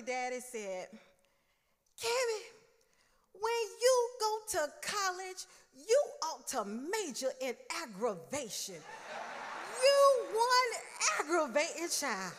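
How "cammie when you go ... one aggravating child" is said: exasperated scolding. Retold or imitated. Exasperated dad scolds nagging daughter.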